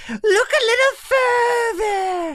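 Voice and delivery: very high, loud, slow coaxing; creepy Santa. Acted. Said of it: "and he says".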